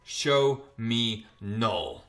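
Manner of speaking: low-pitched, drawn-out order; slow, solemn command. Retold or imitated. Dylan commands the symbiote held before his face, slow and solemn. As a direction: give each word its own weight, slow and low.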